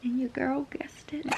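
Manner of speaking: whispered secret about the plot twist; breathy conspiratorial whisper. Real, not acted. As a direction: lean in and whisper it.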